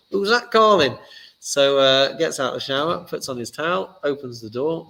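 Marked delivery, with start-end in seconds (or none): 0.1-1.0 a loud Northern English call, pitched up; northern accent. Acted. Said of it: "always got a northern accent for these things".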